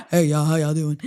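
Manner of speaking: low, soothing pop-diva greeting; mock soothing star voice. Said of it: "Sounds like an off-brand [name]".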